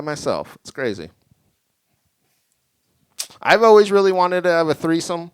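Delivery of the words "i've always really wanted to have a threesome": emphatic first take. Shown as a demonstration. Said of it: "I'm gonna reiterate this premise".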